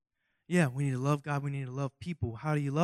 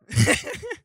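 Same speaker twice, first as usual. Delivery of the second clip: very high, short squealing laugh; high giggle; 7 dB louder than usual. Real, not acted. Laughing at himself.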